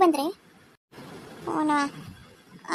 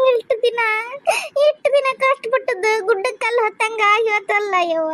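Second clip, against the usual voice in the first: wailing cry; 3 semitones higher than usual. Acted. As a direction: cry out the loss. Mother wailing over lost hopes. (ಜೋರಾಗಿ ಗೋಳಿಡುವ ದನಿ)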